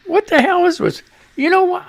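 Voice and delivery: high, annoyed, exasperated; real irritation at the mic; angry outburst. Real, not acted. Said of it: "I'm pissed now".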